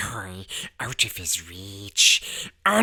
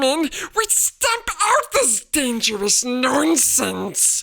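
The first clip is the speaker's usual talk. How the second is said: high, loud outraged huff; indignant uncle; 5 dB louder than usual. A narrator's reading. Husband indignantly refusing to let one into the house. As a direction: sputter with outrage.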